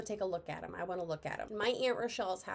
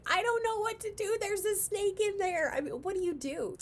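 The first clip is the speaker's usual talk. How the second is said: much higher, louder mock-frantic aunt voice; panicky aunt; 12 semitones higher, 5 dB louder than usual. Niece imitating her aunt freaking out over the snake, high mock panic. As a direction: play the flustered aunt, hands in the air. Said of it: "She's like".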